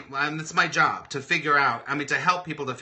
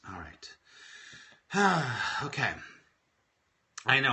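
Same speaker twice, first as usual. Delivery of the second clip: normal talking voice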